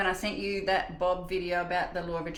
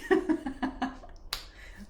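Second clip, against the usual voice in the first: long amused laugh; tickled laughter trailing on; real laugh; 5 semitones higher than usual. Real, not acted. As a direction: laugh it out helplessly. Laughs at the reply.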